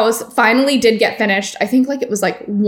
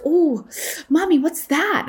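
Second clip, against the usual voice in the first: put-on high, soft little-girl question; curious toddler; 6 semitones higher, 4 dB quieter than usual. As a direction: voice the toddler asking. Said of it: "she's like".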